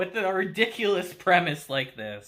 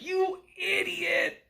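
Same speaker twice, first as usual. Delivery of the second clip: slow exasperated insult; 10 semitones higher, much slower than usual. Retold or imitated. Takafumi mocking his uncle.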